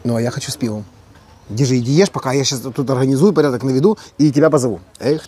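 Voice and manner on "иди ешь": usual speaking voice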